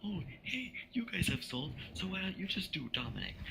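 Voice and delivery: high-pitched mock teacher voice; imitated teacher. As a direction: mimic a teacher, pitched well up without raising volume. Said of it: "our teacher was like".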